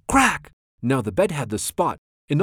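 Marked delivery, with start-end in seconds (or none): 0.1-0.5 sharp, high, loud burst; exclaimed sound effect. A narrator's reading. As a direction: punch it out suddenly like a crash. Sudden sound word in the story.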